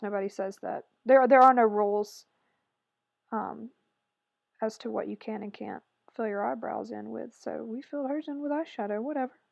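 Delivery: usual speaking voice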